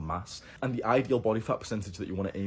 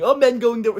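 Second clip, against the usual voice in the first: high, loud mock whine; 12 dB louder than usual. Vlogger mocking critics of men, high mocking whine. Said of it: "because it's so cool to say, like".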